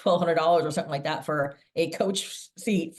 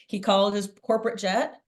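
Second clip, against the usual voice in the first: ordinary talk, nothing marked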